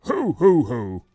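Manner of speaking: jolly ho ho ho. Acted. Santa laugh to kids.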